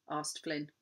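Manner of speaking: ordinary delivery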